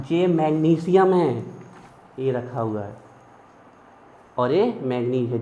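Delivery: ordinary talk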